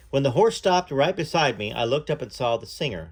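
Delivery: usual speaking voice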